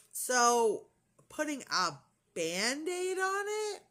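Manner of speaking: slow, stretched question climbing very high; incredulous. Real, not acted. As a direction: draw it out in rising disbelief. Incredulous echo of doctor's order.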